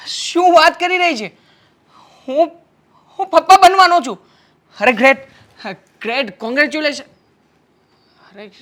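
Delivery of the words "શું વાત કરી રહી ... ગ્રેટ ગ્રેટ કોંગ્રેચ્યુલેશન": can't believe the news, rising with joy; astonished joy. Acted. Husband hears he will be a father. (આશ્ચર્ય અને ખુશીથી ઊંચો અવાજ)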